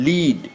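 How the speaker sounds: লিড with a drawn-out ee vowel; লিড with a long, held ee; long ee sound. Shown as a demonstration. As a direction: stretch the vowel of লিড into "leed". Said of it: "দীর্ঘ ই উচ্চারণ হবে".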